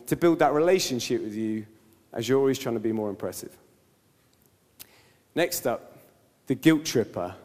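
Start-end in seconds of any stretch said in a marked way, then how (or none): none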